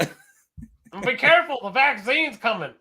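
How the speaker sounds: high, drawn-out cartoonish warning; mock alarm. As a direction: call out a hammed-up warning up high. Reprises the mock vaccine alarm.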